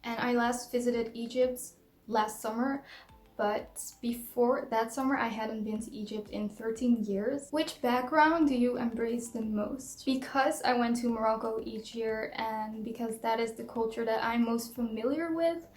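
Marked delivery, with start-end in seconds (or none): none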